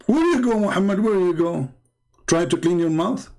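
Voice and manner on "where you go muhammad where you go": taunting. Real, not acted. Taunting caller who left.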